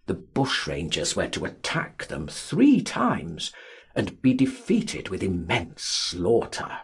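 ordinary delivery

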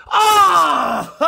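sudden high yelp of delight; excited scream. Real, not acted. Opening packs, he screams at a huge hit. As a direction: shriek at the big pull.

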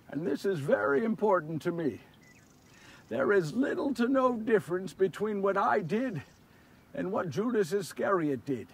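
soft, solemn admission; subdued confession. Acted. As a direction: confide it gravely and quietly. Sober confession after the outburst.

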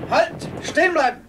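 high, loud barked commands; shouted orders. Acted. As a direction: order everyone to freeze. Tatar soldiers storm in.

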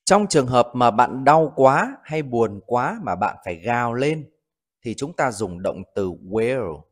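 ordinary talk

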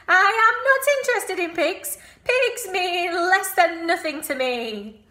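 high, loud, haughty lamb voice; snooty lamb. Acted. Lamb telling the pig he is not interested in pigs. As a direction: look down your nose like a proud little lamb. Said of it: "Did you like my lamb voice".

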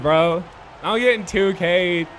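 ordinary delivery

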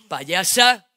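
loud sneering shout; insulting shout. Acted. Boyfriend yelling insult at girlfriend, loud. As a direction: spit out the insult.

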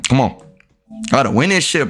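ordinary talk, nothing marked

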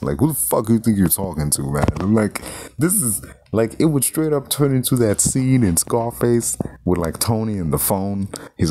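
normal talking voice

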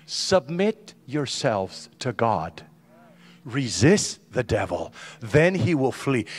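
slow, weighty scripture reading; solemn reading. Shown as a demonstration. Pastor reading James to the congregation, slow and grave. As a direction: read each word like it matters. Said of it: "reading scripture slowly".

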